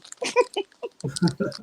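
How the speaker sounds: amused laugh. Real, not acted. Laughs at caller timing.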